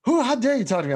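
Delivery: high, loud mock outrage; indignant fake voice. Friend retells a prank caller's indignant outrage. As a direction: ham up an offended caller's fake voice. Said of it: "he tries to do this fake voice".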